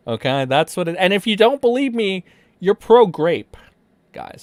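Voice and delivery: loud, over-the-top fake victim testimony; hammed-up, emphatic parody of an accuser; mock accusation. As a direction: protest loudly in pretend earnest.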